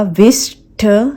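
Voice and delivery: विश्ड as "wish-tuh"; two-beat विश्ड with full ta; full T sound. Shown as a demonstration. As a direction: wrongly add a vowel after t in विश्ड. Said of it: "नहीं है".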